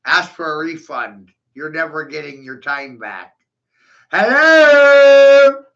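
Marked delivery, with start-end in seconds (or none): 4.1-5.6 loud, high, drawn-out "Hello?"; mocking shout. Real, not acted. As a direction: yell it to an empty room, mocking. Mocking call to silent audience.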